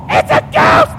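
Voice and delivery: very high, loud panicked yell; super-scared yell. Shown as a demonstration. Showing the crowd how to shout on three, super-scared yell. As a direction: yell it as loud as you can, acting terrified. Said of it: "yell as loud as you can".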